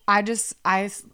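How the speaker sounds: ordinary talk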